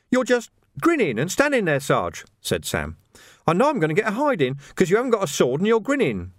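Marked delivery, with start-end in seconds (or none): 0.1-2.2 high, uneasy lad's voice; nervous young recruit. A narrator's reading. Nervous recruit facing grinning sergeant. 3.5-6.3 high-pitched, anxious lad; worried junior, expecting a hiding; nervous young recruit. A narrator's reading.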